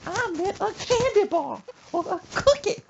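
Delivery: high, loud screech; mock angry mama. Mother sending son back to store, screechy mock command. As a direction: screech it as an order.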